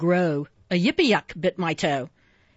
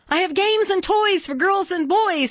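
high, cheerful Santa voice; cheery; 11 semitones higher than usual. A narrator's reading. Santa offers his toys to the reindeer, cheery. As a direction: pitch way up, brightly listing presents. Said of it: "said Santa cheerily".

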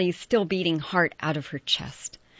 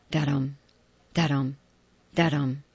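heartbeat da-dum; 3 semitones lower than usual. A narrator's reading. Heartbeat sound effect chant.

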